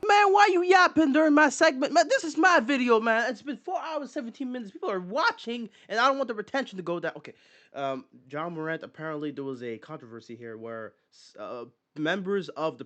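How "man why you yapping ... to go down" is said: much higher, whiny mock complaint; high-pitched mock whine at the clip; mock indignant. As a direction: play the put-upon host, squeaky and fed up. Mock scolding clip over his video.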